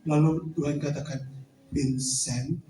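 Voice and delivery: the Lord speaking low and soft to Vincent; voice of God. Acted. God's voice told in a testimony.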